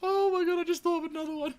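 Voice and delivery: high-pitched laughing disbelief. Real, not acted. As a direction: squeak it out through laughter. Laughing at own joke.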